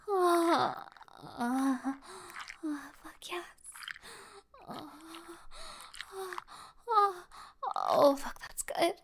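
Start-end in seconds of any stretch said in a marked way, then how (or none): 2.6-8.9 breathy moans. Acted. Moaning in pleasure.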